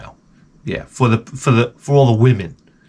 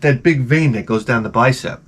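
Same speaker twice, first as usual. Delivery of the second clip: usual speaking voice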